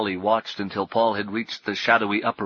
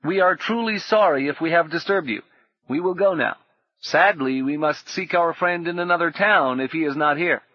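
stranger's voice; 7 semitones higher, 5 dB louder than usual. A narrator's reading. Stranger feigning regret.

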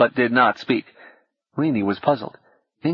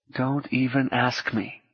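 quiet aside; 6 dB quieter than usual. A narrator's reading. Rini mutters aside to Habu.

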